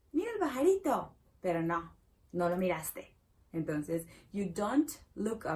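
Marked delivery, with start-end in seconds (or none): 0.1-1.1 excited. Shown as a demonstration. Translating excited call.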